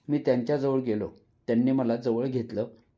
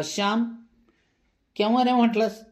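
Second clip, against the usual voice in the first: put-on teasing boy; 9 semitones higher, 4 dB louder than usual. Boy teases Shyam.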